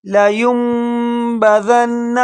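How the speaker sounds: very slow chanted لینبذن; drawn-out tajweed chant; slow recitation; slow Quran recitation. Shown as a demonstration. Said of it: "بڑی mistake".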